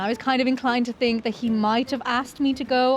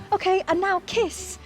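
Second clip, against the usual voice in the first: tour guide voice; 8 semitones higher, slower than usual. Retold or imitated. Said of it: "he was like".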